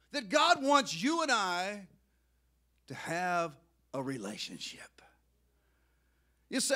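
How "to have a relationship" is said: hushed whisper. Real, not acted. Preacher whispering to his congregation about God. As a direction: breathe it out as a whisper.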